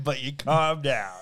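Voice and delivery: slow, loud, laughing tease; mock reassurance. Co-host teasing with drawn-out mock line.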